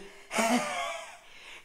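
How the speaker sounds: high crazed cackle; maniac laugh. Pretend. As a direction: laugh like a madman. After Here's Johnny.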